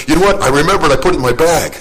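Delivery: blurting out that he just remembered; sudden realization. Retold or imitated. Pastor telling the driver he found the Bible, excited realization.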